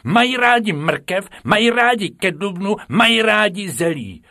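very high, loud schoolgirl recital; shrieky-high know-it-all pupil; eager star pupil. A narrator's reading. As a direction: beg to answer, then rattle off facts keenly. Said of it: "nejlepší žákyně třídy, se hned hlásila".

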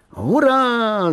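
high-pitched drawn-out hurrah; triumphant shout. A narrator's reading. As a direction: cry out in jubilation like an excited boy. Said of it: "zakřičel tak".

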